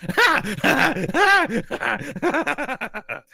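very high, gasping "ha" bursts; breathless forced laugh. Acted. Running gnome under laughing spell, breathless high-pitched laughter. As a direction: laugh helplessly while catching your breath on the run. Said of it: "trying to laugh and run".

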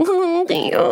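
high, mock-polite thank you; awkward thanks. Actress warned the dog isn't friendly, awkward high thanks. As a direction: squeak an embarrassed thanks. Acted reply to dog warning.

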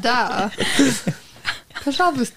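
mock-Russian sign-off; brief, hammed-up Russian; Russian accent. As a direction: play along in Russian accent. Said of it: "Russia edition".

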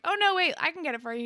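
retold high-pitched eager interjection; eager offer. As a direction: imitate someone hurrying to offer help. Retelling eager offer to fetch.

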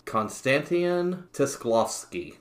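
"Konstantin Tisklovsky" said high and slow, an admittedly wrong American reading; mangled Russian name. Shown as a demonstration. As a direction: sound out "Tisklovsky" carefully, knowing it is wrong. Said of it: "I am gonna pronounce this name wrong".

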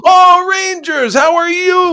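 high, very slow, overjoyed cry; delighted squeal. Retold or imitated. Amoruso greets a Rangers fan, high delighted squeal. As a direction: squeal it like a thrilled old hero. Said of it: "I'm a Rangers fan".

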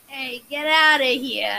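loud, drawn-out fake Boston accent; bad Boston accent, forced and shouted out. Friend shows off a failed Boston accent, forced and loud. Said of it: "if you're trying to force a Boston accent".

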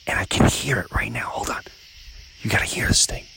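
hushed whisper. Real, not acted. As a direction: whisper while listening out for something. Listening for a screech owl nearby.

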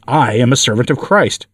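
plain reading voice

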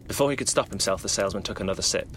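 ordinary delivery, nothing marked

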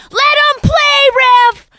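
put-on high, loud, drawn-out kid yell; shrieky little-kid sideline shout; imitating a six-year-old heckler. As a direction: yell at the ref like a tiny fan, stretching every word. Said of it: "Gabby's like".

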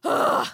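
a loud, high groan of frustration; frustrated ugh. Retold or imitated. Fed-up woman describing her mood, loud frustrated ugh. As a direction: let out an exasperated ugh. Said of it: "my demeanor is just kind of like".

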